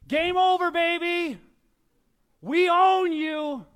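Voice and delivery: loud high trash-talk yell; sideline shout. Retold or imitated. Pastor reliving his sideline trash talk, loud yell. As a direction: yell it across the field at the other team. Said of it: "I love to shout from the sidelines".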